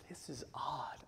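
whispered, low aside; awkward aside. Retold or imitated. Boyfriend holding plate of cookies, whispered awkward aside. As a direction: whisper it to yourself, mock uneasy. Retells his awkward inner reaction.

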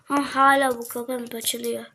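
normal talking voice

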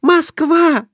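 very high, delighted shriek; wife shrieking with joy at her win; joyful cry. A narrator's reading. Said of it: "радостно вскрикнула".

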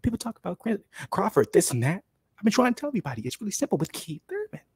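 impression of a cocky fighter talking; imitating Keith Thurman. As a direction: play the fighter, not yourself. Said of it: "I can imagine him talking".

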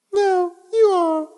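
doting bird voice. Acted. Lovebirds trade compliments.

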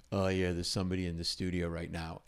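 low, soft, indifferent reply; quiet, low-pitched brush-off; douche intern. Retold or imitated. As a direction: play an unbothered intern. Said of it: "this, like, intern douche goes".

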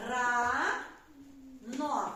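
РАНО split with a long pause between syllables; Рано drawn out, each syllable separate; syllable-by-syllable dictation. Shown as a demonstration. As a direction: dictate "Рано" slowly for children writing. Dictates syllables while class writes.

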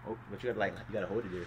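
hushed. Real, not acted. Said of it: "whispered walk-through before kiss".

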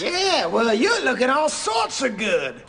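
slow, loud mock Southern drawl; Southern accent. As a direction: lay on a folksy Southern accent. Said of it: "We would have Southern accents".

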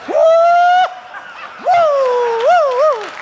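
high loud ecstatic whoops; Chicken Woman whoops. Retold or imitated. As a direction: whoop like a woman caught up in church. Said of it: "Chicken Woman jumped up and started".

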